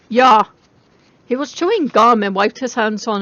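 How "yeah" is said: blunt bored reply. Acted. Bored clerk answer.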